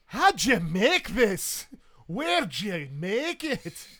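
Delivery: high, loud, drawn-out amazement; astonished Scottish demand; Highland Scot. Acted. Scot after downing the rum can, amazed Highland bellow. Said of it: "this world's equivalent of a Highland Scot".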